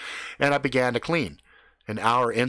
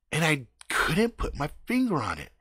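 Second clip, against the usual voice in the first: ordinary delivery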